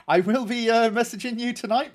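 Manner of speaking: ordinary delivery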